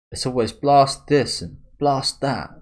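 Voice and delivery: lightly voiced sulky character; mock whiny complaint. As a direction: whine it like a moody teen. Reading a complaining character's line.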